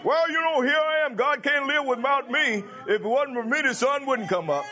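high-pitched mock self-important voice; mock boastful talker. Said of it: "They talk like this".

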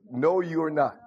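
ordinary delivery

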